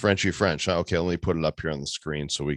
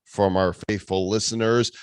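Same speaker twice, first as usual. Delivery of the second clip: ordinary talk, nothing marked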